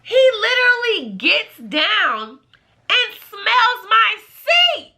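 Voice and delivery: high, slow, scandalized squeal; slow high-pitched outrage; appalled exclamation. Real, not acted. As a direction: stretch it out high in disbelief. Appalled, stretches out the shocking act.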